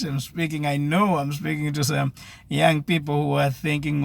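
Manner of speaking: ordinary delivery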